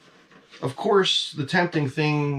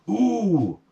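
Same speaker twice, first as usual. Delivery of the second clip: surprised gasp; 9 semitones higher than usual. Real, not acted. Finding extra parts in box.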